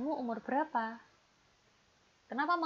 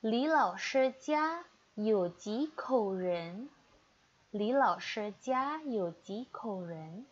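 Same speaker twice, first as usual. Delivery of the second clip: slow model reading; 5 dB louder, much slower than usual. Shown as a demonstration. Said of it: "teacher models Mandarin phrase".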